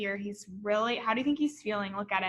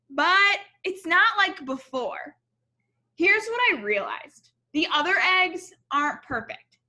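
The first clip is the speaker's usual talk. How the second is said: story narration; 3 semitones higher, 4 dB louder than usual. A narrator's reading. Reads picture book aloud.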